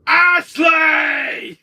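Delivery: high, full-volume drawn-out yell; hammed-up angry name call; Wesker. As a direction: bellow the name like an enraged villain. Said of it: "here's one me and Wesker saying this one".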